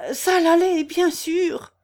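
high, loud villager voice; excitable villager. A narrator's reading. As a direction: exclaim like an excited countryman. Villager reacting to fire news.

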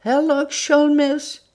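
slow, choked, high gasped name; strangled stammer. Acted. Wilson pinned by the throat, strangled stammer. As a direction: force the name out through a squeezed throat. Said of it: "balbutia une voix étranglée, caverneuse".

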